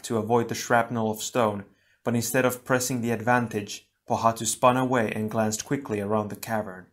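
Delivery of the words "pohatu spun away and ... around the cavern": usual speaking voice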